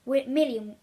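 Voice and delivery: ordinary delivery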